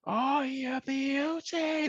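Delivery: hugely drawn-out, high commentator's cry; Andy Gray. Retold or imitated. Host imitating a commentator at a thunderbolt goal, high elated cry. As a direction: imitate a pundit erupting at a goal, stretching every word. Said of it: "Andy Gray for Gerrard's thunderbolt".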